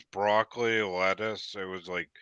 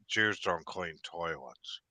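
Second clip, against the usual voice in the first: deadpan buddy; 3 semitones lower, 8 dB quieter, slower than usual. Retold or imitated. Buddy's deadpan explanation for quitting.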